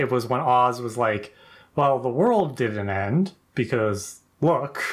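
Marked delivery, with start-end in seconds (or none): 1.8-4.8 slow, low deadpan delivery. Retold or imitated. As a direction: state the obvious slowly and dryly. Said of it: "It was the deadpan of".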